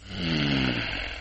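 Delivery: very low, long growling hum; low soft predatory "mm"; wolf's hum. Acted. Wolf sniffing out Sheppy, low menacing hum. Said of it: "He starts sniffing the wind".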